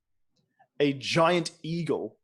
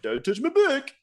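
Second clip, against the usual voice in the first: king voice; 9 semitones higher, 3 dB louder than usual. Acted. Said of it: "as a way of it".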